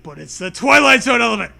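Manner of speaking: loud fast spooky reveal; dramatic reveal. Acted. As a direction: announce it dramatically.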